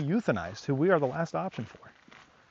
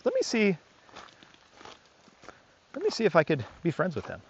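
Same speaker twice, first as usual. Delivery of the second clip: hopeful dog; 6 semitones higher than usual. Retold or imitated. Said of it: "They start using their nose".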